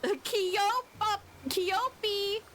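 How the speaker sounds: high cutesy pet-name call; pet cooing. Real, not acted. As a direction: coo the nickname sweetly.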